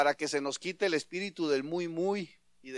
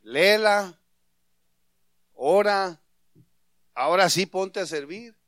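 loud, punchy orders with pauses between; imagine God ordering someone about, curt and forceful; hammed-up bossy divine command; brusque, harsh command; 6 dB louder than usual. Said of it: "muy golpeado eso".

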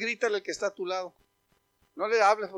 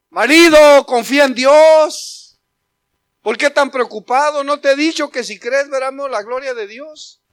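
fervent exhortation; 6 semitones higher, 13 dB louder than usual. Acted. Wife urging her worried husband, loud exhortation. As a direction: call him out of his worry.

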